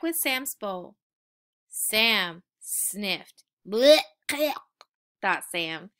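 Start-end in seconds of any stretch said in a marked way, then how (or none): 3.7-4.0 loud, spat-out blech; disgusted blech. A narrator's reading. Puppy recoiling from the smell of dog food. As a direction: spit it out in disgust.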